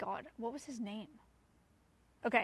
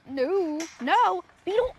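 loud shouts climbing higher; alarmed shout; 6 semitones higher, 9 dB louder, slower than usual. Real, not acted. Owner to Beetle trying to come along, alarmed shout. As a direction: cry out to halt the animal.

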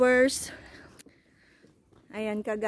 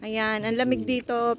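ordinary talk, nothing marked

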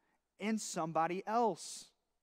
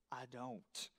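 low, much softer mumble; sheepish; 9 semitones lower, 15 dB quieter than usual. Retold or imitated. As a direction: barely admit it. Friend admits no idea.

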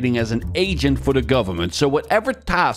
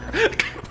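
high giggle breaking character; can't hold it together, laughing; breaking into laughter; 4 dB quieter than usual. Real, not acted.

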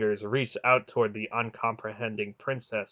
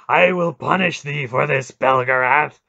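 loud, wrathful snarl; furious vow of punishment; snarled threat; 4 semitones higher, 10 dB louder than usual. A narrator's reading. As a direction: snarl it out at full voice. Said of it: "Mara snarled".

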